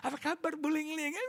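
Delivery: high, very soft, airy sweet hostess greeting. Retold or imitated. Imitating a gracious pastor's wife greeting the guest softly and sweetly. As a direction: coo the welcome sweetly. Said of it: "kalau Ibu Evelyn kan".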